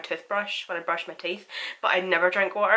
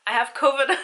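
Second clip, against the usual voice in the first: laughing; 7 semitones higher, 5 dB louder than usual. Real, not acted. Laughing at being sick.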